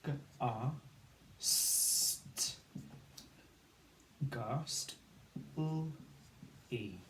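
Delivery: G, R, st as separate sounds with drawn-out st, blended to ghast, then L, E; sounded out. Shown as a demonstration. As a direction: phonics sounds for G, R, st, ghast, L, E, one at a time. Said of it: "The A spelling of R".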